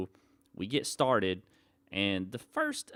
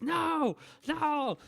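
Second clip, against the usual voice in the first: high, panicked mock scream of no; retold screaming no. On the first drop, screaming no in imitated terror. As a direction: squeal no in a replayed panic. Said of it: "screaming out of my mind".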